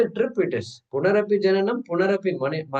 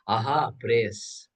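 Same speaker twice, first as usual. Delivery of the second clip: ordinary talk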